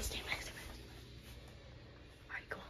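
hushed sneaking whisper. Real, not acted. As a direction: whisper so no one hears. Sneaking past guards, whispering.

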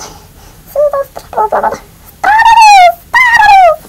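very high shrieking chipmunk voice. Pretend. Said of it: "do the chipmunk voice until I end this video".

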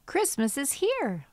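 normal talking voice

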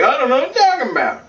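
high, quick protest; mock innocent denial. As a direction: blurt a put-on denial, high and fast. Burke denies it.

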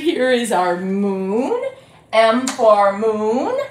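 slow, stressing moon; slow emphasis. Shown as a demonstration. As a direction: spell it out slowly for kids. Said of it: "starts with the letter M".

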